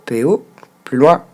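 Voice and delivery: ordinary delivery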